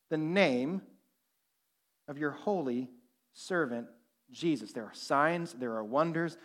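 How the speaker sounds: low, soft, slow prayer reading; reverent hush. Acted. Preacher reading the disciples' prayer to the congregation in a hush. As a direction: read it like a whispered prayer.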